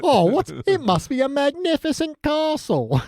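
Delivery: awed mock British accent. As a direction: gush in a silly British voice. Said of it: "that's just the accent we use".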